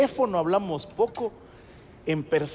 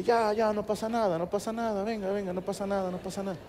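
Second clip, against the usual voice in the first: soothing grandfather; 3 semitones higher, 5 dB quieter than usual. Retold or imitated. Grandfather lifts crying grandson, soothing him. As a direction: hush a crying child gently, fading softer.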